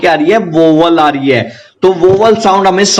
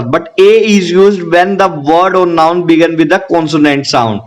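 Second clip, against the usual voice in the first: very slow, word by word; slow dictation; much slower than usual. Shown as a demonstration. Teacher dictating the rule to students, slow and deliberate. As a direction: spell out the rule slowly for notes. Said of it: "teacher dictating rule slowly".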